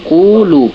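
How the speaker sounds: firm final demo of কুলু with a long উ; stretched vowel demo. Shown as a demonstration. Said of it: "তবে তো ওয়াওটা টান হবে".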